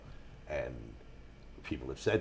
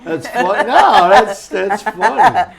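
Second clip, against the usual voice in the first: loud, high, laughing words; real laughter; 12 semitones higher, 18 dB louder, slower than usual. Real, not acted. Reacting to a listener's Jell-O shots joke, laughing loudly. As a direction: talk through a big laugh.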